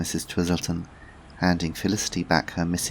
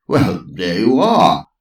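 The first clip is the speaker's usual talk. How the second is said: slow, louder triumph; triumphant; 10 dB louder, slower than usual. Acted. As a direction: crow with delight. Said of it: "said Sir Lancelot triumphantly".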